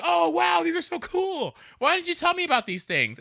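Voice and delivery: put-on high, awed boyfriend voice; boyfriend amazed. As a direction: imitate him discovering the new powers, wide-eyed. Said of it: "he was like".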